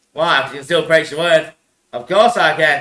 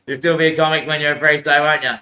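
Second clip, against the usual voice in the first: normal talking voice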